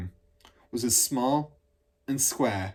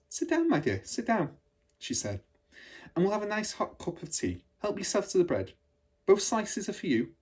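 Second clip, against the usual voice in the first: higher, gentle welcoming teacher voice; kind hostess; 9 semitones higher than usual. Acted. Miss Honey welcomes Matilda to tea, gentle and higher. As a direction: make the child feel at home.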